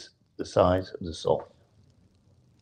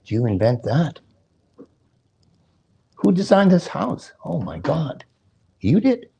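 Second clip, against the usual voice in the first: rising, higher and higher in amazed praise; amazed admirers; 5 semitones higher than usual. Retold or imitated. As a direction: gush like an impressed guest. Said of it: "And people go".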